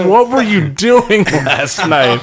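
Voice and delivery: high-pitched, laughing through the words; cracking up while quoting. Retold or imitated. Laughing retelling of friend's story.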